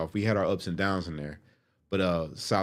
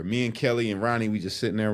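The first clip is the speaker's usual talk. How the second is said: normal talking voice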